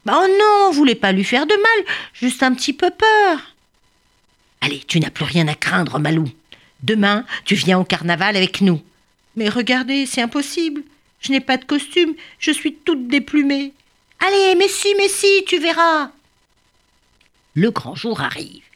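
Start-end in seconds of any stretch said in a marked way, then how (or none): 0.0-3.6 high, louder whining plea; whiny ostrich. Acted. Ostrich pleading innocence. 9.4-16.1 higher sad then encouraging voices; lightly voiced birds. Malou and bird exchange.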